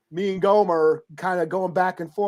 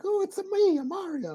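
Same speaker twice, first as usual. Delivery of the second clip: put-on high-pitched campy Italian accent; sing-song cartoon Italian, lightly mocked; Mario; 12 semitones higher, 4 dB quieter than usual. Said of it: "campy Italian accent".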